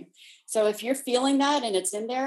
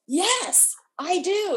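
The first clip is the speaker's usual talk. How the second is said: high, emphatic retold child's protest; insistent child; 9 semitones higher, much slower than usual. Four-year-old arguing back at grandmother, high and insistent. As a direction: imitate a small girl insisting, pitched well up. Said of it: "her voice was getting louder and louder".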